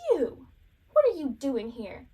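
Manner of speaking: loud, indignant mock accusation; crabby. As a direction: imitate a crabby kid confronting someone. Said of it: "he's kind of crabby".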